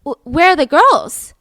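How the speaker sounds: a father's slow, loud alarm; alarmed call. Retold or imitated. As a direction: call out in sudden worry for the missing girls. Father asking for missing girls.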